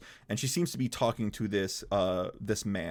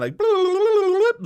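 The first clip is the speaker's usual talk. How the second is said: high squeaky bloops; Batman logo sound effect; 8 dB louder than usual. Pretend. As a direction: sing the transition noises high. Imitating Batman logo zoom.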